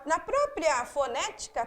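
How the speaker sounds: ordinary delivery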